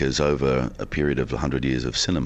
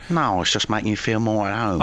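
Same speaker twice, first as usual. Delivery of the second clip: higher-voiced put-on London accent; 8 semitones higher than usual. Actor teasing the radio host in a higher-voiced London accent. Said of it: "I was giving you an accent".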